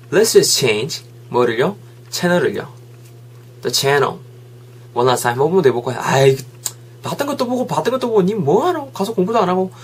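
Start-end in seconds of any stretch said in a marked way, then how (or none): 6.0-9.7 high-pitched, exasperated sibling nag; nagging complaint. Acted. Sibling nagging about reruns and skipped studying, high exasperated complaint. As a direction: scold in a raised, whiny pitch.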